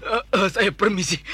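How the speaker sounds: loud frightened stammering; scared stammer. Acted. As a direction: stutter out a hasty yes.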